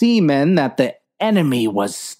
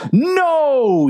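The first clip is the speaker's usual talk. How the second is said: anguished yell; 12 semitones higher than usual. Acted. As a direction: scream it as your men fall. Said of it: "yelled the captain".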